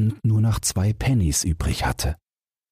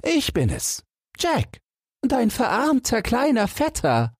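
put-on much higher, cheeky young Jack. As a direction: toss it off brazenly, pitched way up. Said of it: "woraufhin Jack rotzfrech antwortete".

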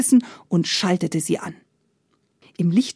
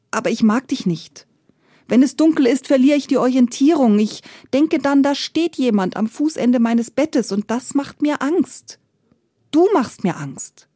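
higher-pitched, anxious protest; mildly scared, pitched up and insistent; 6 semitones higher than usual. A narrator's reading. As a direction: voice a frightened man confessing his fear. Frightened man confronts the darkness.

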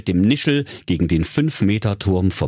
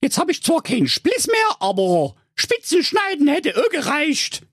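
put-on much higher cheeky young girl voice; Rapunzel. Rapunzel mocking the witch, high-pitched girl voice. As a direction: play Rapunzel high and sassy. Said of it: "Da sprach Rapunzel".

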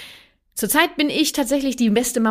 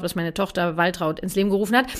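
usual speaking voice

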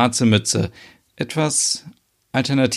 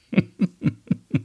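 helles, kurzes Auflachen; lachen; 7 semitones higher than usual. Acted. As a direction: ein amüsiertes Kichern, hoch und hell. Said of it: "Reika lacht". (amüsiertes hohes Lachen)

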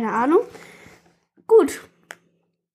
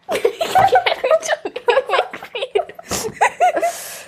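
high laugh. Real, not acted. Laughs at comment.

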